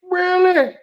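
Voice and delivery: squeaky high disbelieving really; a high put-on gasp of surprise; mock surprised high voice. The forgetful guy asks in disbelief, squeaky high.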